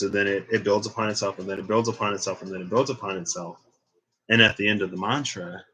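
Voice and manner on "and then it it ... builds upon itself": repeating it softer. Shown as a demonstration. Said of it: "I say a line a few times".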